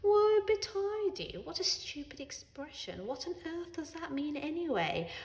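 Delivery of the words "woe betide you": mocking. A narrator's reading. Mocking the father's phrase.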